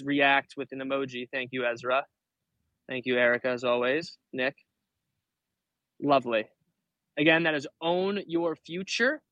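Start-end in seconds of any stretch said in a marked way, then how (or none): none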